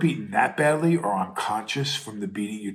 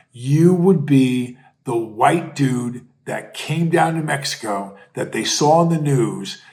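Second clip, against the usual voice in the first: ordinary talk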